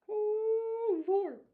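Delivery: loud, drawn-out falsetto wail on one held note; high-pitched exclamation. Real, not acted. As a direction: wail it way up high, dropping at the end. Exclaims to himself while checking work.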